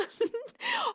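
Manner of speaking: squealing burst of laughter; high squealing laugh. Real, not acted. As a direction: shriek with laughter. Cheer count spikes to level ten.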